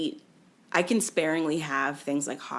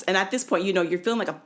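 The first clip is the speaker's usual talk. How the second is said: normal talking voice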